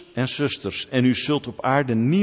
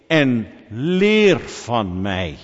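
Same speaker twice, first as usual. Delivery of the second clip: "leer van mij" drawn out very slowly and loud; slow emphatic correction; 3 semitones lower, 6 dB louder, much slower than usual. Shown as a demonstration. Preacher correcting a false quote of Jesus, very slow and loud. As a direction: lean on "leer", stretching every word.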